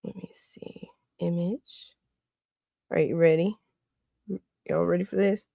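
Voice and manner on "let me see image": breathy aside under the breath; whispered mutter while busy. Real, not acted. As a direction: whisper to yourself.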